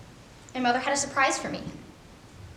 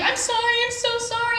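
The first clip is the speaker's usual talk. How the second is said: mock sobbing apology; 9 semitones higher than usual. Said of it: "she's bawling".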